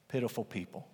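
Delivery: soft hushed repeat; quiet echo. Shown as a demonstration. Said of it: "Christians are pitiful people".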